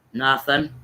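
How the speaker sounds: low, deadpan brush-off; reluctant answer. Retold or imitated. Adult brushing off a kid online, reluctant.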